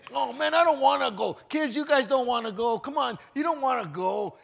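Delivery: high, loud whiny complaining; family grumbling about church; mock whining. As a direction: whine like a reluctant kid.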